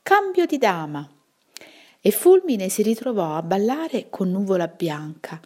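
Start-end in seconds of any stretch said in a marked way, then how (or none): 0.0-1.1 mild call. Acted. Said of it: "qualcuno gridò".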